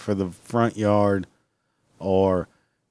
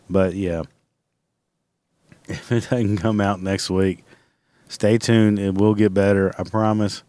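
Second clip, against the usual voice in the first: usual speaking voice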